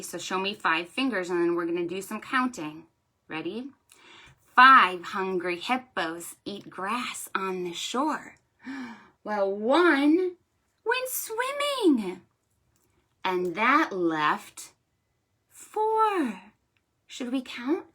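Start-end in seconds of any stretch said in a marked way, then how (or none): 4.6-16.4 storytime rhyme. Shown as a demonstration. Librarian reciting a counting rhyme to children. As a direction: read it like a picture-book rhyme.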